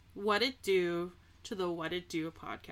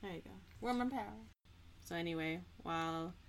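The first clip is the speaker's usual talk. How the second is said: usual speaking voice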